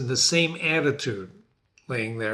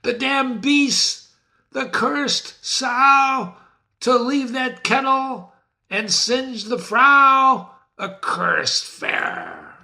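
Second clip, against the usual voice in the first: high, loud, slow furious witch; enraged witch scream; 10 semitones higher, 4 dB louder, much slower than usual. Acted. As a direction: rage at the beast who let it burn. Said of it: "she's screaming".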